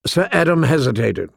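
plain reading voice

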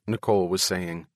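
plain reading voice

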